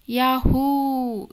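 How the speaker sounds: a long, stretched, loud yahoo of triumph; joyful yell. Retold or imitated. Lover yelling for joy while dancing, long loud yahoo. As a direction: shout it like you just conquered the world. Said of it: "గట్టిగా అరిచేశాను".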